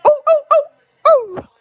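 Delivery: string of high falsetto oohs; quick high hoots to sign off; high whoops. Real, not acted. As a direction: whoop "ooh" repeatedly, very high. Whooping sign-off after his point.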